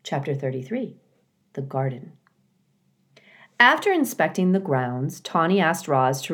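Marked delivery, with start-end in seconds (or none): none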